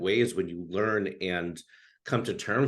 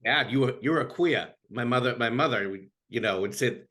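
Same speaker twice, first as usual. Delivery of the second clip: ordinary talk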